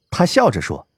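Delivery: ordinary delivery, nothing marked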